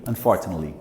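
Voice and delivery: "Unfortunately" as un-FORT-n-li, low and soft; quick, soft "Unfortunately" minus the "ate"; reduced form. Shown as a demonstration. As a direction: swallow the ending of "Unfortunately". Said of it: "como se não tivesse isso aqui".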